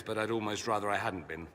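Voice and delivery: usual speaking voice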